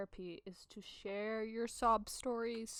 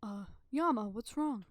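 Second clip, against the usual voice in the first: tired man voice. Acted. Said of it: "here's my man tired voice".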